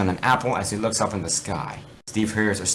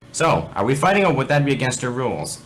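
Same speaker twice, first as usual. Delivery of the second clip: teasing challenge; 5 semitones higher, 4 dB louder than usual. Acted. Alex challenging Steve.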